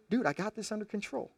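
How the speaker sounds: cocky man waving off advice; dismissive brush-off. Retold or imitated. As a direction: wave off the worry lazily. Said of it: "Samson seems to say".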